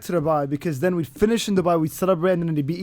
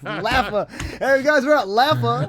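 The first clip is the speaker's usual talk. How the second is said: upbeat American vlogger intro, a bit higher; American vlogger accent; 6 semitones higher, slower than usual. Shown as a demonstration. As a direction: greet your viewers like an American YouTuber. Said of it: "an American accent?".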